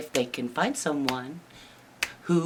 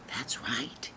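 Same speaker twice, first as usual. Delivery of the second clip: ordinary delivery, nothing marked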